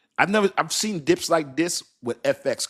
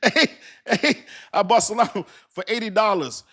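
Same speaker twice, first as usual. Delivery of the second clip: excited; 7 semitones higher, 3 dB louder than usual. Real, not acted. Telling viewers the Solana buy.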